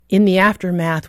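usual speaking voice

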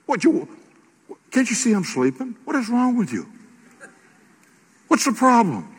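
high, mock-annoyed grumble at being woken; irritated Jesus. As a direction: snap like someone roused from a nap. Jesus woken in storm, ticked.

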